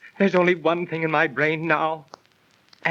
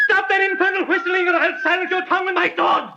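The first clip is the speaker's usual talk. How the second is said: higher, furious warning; angry threat; 10 semitones higher than usual. Acted. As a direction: threaten him with your sword, voice raised high. Threatens the whistler with sword.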